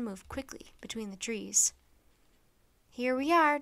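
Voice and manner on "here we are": eager. Acted. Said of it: "Jack said".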